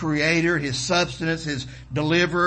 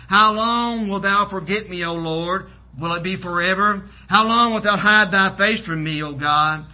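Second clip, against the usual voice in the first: raised, declaimed plea; crying out to God; 5 semitones higher, 6 dB louder than usual. Retold or imitated. Preacher voices David pleading with God, crying out. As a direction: preach the psalmist's cry out loud. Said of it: "David cries out before God".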